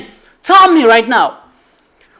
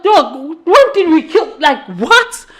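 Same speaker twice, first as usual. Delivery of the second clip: higher, laughing mock outrage; friends' disbelief; 6 semitones higher than usual. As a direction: play the friends baffled and amused. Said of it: "the guys are like".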